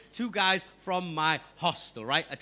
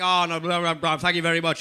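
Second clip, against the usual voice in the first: mouth full; 4 semitones lower, 4 dB louder than usual. Retold or imitated. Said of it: "my mouth's full of chicken".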